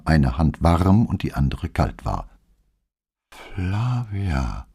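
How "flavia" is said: barely audible, breathy name; faint whisper. A narrator's reading. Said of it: "flüsterte sie kaum hörbar".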